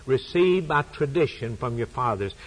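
impassioned sermon climax; preaching. Acted. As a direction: drive the point home from the pulpit. Preaching at full force.

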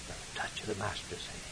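breathy hushed whisper. Real, not acted. Preacher closing the poem in a reverent whisper.

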